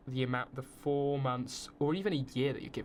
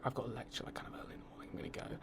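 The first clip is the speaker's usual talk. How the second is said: near-whisper, much softer, trailing to breath; hushed excuse; 10 dB quieter, much faster than usual. Retold or imitated. Leaving the date, whispered excuse about a lecture. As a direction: slip away with a murmured excuse. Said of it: "I was like".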